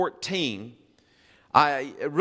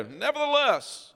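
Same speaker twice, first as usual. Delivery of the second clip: Nevertheless said much higher and slow, defiant; presumptuous; 11 semitones higher than usual. Shown as a demonstration. Israelites defying Moses, high and drawn-out Nevertheless. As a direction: sing out Nevertheless like stubborn defiance. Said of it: "I'm just gonna presume".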